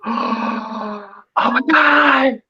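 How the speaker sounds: high, drawn-out "oh my God"; mock shocked gasp. Retelling Johnny's shock at looking down, mock horrified. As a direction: stretch it out in mock horror.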